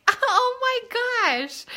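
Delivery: very high, drawn-out gush; overwhelmed with delight, stretched out high; touched gushing. Retold or imitated. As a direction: melt into a slow, high swoon. Reacting to the note, retold.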